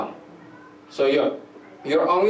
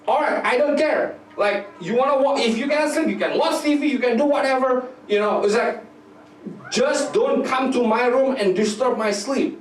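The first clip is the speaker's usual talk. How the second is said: clearly higher fed-up dad brushing her off; a tired dad sending his daughter off to watch TV, higher and dismissive; 9 semitones higher than usual. Retold or imitated.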